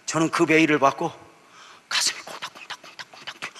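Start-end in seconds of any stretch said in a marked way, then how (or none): 1.9-3.5 whispered heartbeat, hushed and tense; anxious whisper. Retold or imitated. Pastor whispers his pounding heart to the congregation. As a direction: breathe it like a racing heart.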